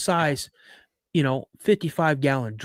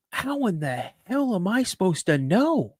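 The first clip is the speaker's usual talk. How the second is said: higher, mock-indignant comeback; exasperated retort; 5 semitones higher than usual. Son answering his accusing dad, exasperated mock protest.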